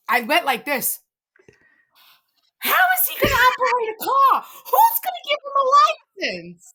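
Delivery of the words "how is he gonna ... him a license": high, loud, horrified outburst; alarmed shout. Real, not acted. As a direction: shriek the question in disbelief. Horrified mom at teen driving.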